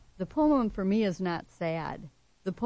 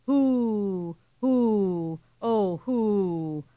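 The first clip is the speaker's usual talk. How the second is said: long, louder dove coos, each gliding down; moaning doves; 3 semitones higher, 7 dB louder, much slower than usual. A narrator's reading. Poet voices doves as the hummingbird dies, drawn-out moaning coos. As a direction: moan it out slowly like a mourning dove. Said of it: "Morning doves moaned".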